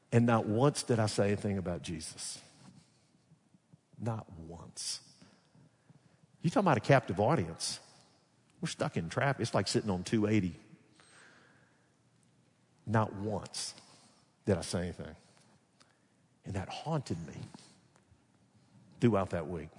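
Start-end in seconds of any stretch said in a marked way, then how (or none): 0.1-5.0 hushed, regretful admission; sober confession. Real, not acted. Regretful confession after excited story. 12.9-19.7 soft, low, halting regret; sober confession. Real, not acted. Repeats confession that haunted him.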